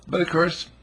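ordinary delivery

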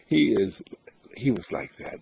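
ordinary talk, nothing marked

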